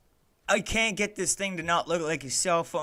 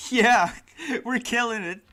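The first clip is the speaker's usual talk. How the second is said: sarcastic laugh; 7 semitones higher, 9 dB louder than usual. Real, not acted. Sarcastic laugh at broken project.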